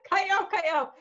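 high, urgent repeated plea; hammed-up frantic begging; mock panic. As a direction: plead in comic alarm. Retold panicked plea on live call.

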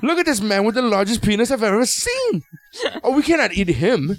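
high-pitched, hammed-up British jaguar; British accent. Jaguar refusing to eat a man, mock British accent. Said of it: "the Jaguar's British in this one".

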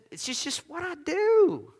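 high, drawn-out mock helplessness; mock whiny excuse. Preacher mimicking someone excusing a temper, mock whine. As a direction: whine it slowly, climbing high and soft.

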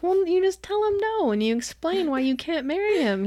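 high-pitched retold explaining; high, singsong imitation of advice; mock obvious advice. Acts out cousin giving obvious advice.

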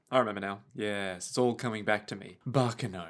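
quietly dramatic, hammed-up; mock ominous. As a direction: intone it like a spooky recollection.

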